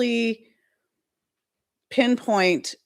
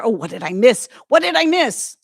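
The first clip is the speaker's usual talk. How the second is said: surprised; 4 semitones higher, 7 dB louder than usual. Real, not acted. Suddenly reacting to something missed.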